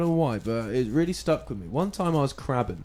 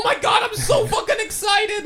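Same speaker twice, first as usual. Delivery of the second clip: hammed-up, very high-pitched excited shout; 5 dB louder than usual. Shown as a demonstration. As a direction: squeal it out, loud and overexcited. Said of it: "when I'm excited".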